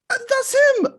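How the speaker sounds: very high, loud excited squeal; excited recognition. Retold or imitated. Audience woman spotting him onstage, a loud high squeal. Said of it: "a woman in the audience did go".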